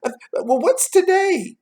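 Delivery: very high, loud call; yelling down. A narrator's reading. Scrooge calling to a boy below, yelling. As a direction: call down to the street, pitched high. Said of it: "He yelled down to the street".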